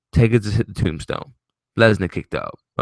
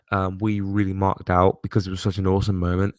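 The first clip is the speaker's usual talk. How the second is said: normal talking voice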